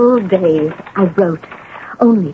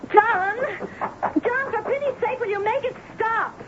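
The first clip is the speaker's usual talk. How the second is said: high-pitched call from upstairs; 12 semitones higher, 12 dB quieter than usual. Acted. Wife calling down to John to stop the knocking, high and strained. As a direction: cry out to a husband far below.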